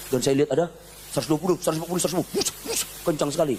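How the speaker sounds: usual speaking voice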